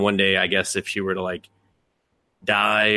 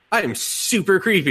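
mock creepy brother; 10 semitones higher than usual. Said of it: "he's just like".

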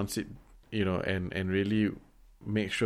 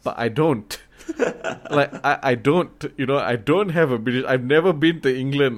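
laughing protest; 3 semitones higher, 5 dB louder than usual. Retold or imitated. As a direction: insist you don't, louder and half laughing. Retells protesting to friends about accent.

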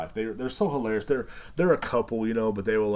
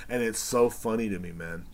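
ordinary delivery, nothing marked

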